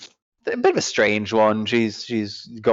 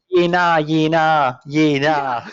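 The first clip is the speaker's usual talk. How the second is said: mock Aussie accent, pitched up; imitated Australian; 4 semitones higher, slower than usual. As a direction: tease the Australian default, drawn out. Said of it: "you default to just going".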